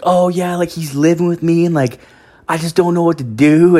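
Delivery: a hint of a worried young woman; imitating girlfriend's friend. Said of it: "this girl's like".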